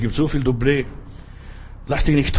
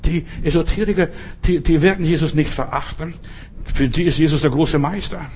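usual speaking voice

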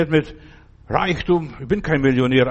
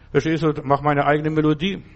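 ordinary delivery

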